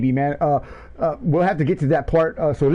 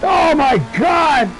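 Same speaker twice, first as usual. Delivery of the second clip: frenzied race play-by-play; 13 semitones higher than usual. Real, not acted. Commentator calling a last-lap fight to the line, frenzied shouting. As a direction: scream the finish as it happens, voice soaring.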